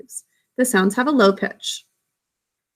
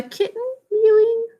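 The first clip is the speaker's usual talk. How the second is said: high, sustained mewing tone; high-pitched kitten voice; 12 semitones higher than usual. Shown as a demonstration. Teacher explaining pitch, high kitten voice. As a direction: lift it right up like a mew. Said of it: "makes a high-pitched sound".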